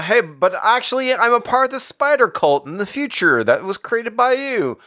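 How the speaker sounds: high, put-on eager explaining voice; mock confession. Reviewer inventing Miles's explanation to Peter, high put-on voice. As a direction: pitch it up as a goofy explanation.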